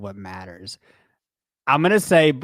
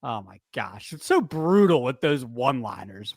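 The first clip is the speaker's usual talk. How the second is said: ordinary talk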